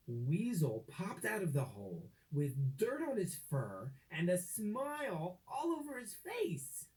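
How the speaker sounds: usual speaking voice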